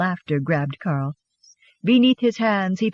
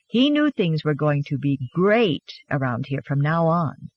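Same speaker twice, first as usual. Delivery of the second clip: ordinary delivery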